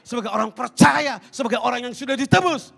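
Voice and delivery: loud, high-pitched impassioned preaching; full-force preaching. Acted. Preacher rousing the congregation, loud and impassioned.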